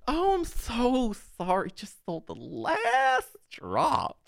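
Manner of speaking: high and very slow, sing-song sorry; milk each word like a gushing barista; mock hyper barista. Barista saying they ran out, high, slow and gushing. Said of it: "So he's like".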